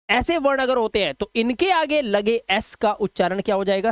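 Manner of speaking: ordinary talk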